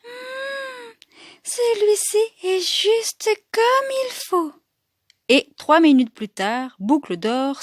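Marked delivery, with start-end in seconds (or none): 0.0-0.9 soft, high hummed sigh; contented sigh. Acted. Goldilocks settling into the little bed, soft high sigh. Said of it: "et soupire". 1.4-4.5 high-pitched, contented Goldilocks; little girl voice. Acted. Goldilocks settling into the little bed, high girlish contentment.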